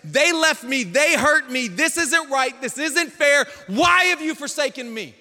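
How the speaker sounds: loud, high lament; anguished lament. Retold or imitated. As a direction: cry out the complaint. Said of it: "I wrote it like this".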